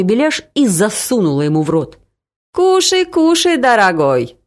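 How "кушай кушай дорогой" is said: put-on coaxing mother with a Caucasian accent, much higher on кушай; pushy Caucasian hostess, кушай, кушай; Caucasian-accented auntie urging food. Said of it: "сказала она с кавказским акцентом".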